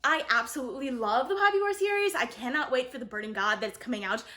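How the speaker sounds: ordinary delivery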